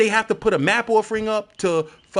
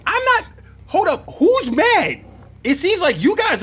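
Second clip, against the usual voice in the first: high, loud denial; defensive; 12 semitones higher, 4 dB louder than usual. Real, not acted. Answering chat who says he is mad, high and loud. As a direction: squeal the denial. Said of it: "Who's red?".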